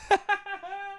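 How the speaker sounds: soft high staccato laugh; short, quiet high-pitched titter; high giggle. Real, not acted. Laughs at his own concession.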